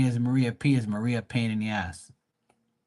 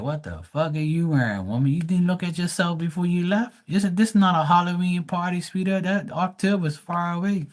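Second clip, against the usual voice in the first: higher, sassy mocking voice; 8 semitones higher than usual. Mocking the woman in a high sassy voice. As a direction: talk down to her with sweet fake sass.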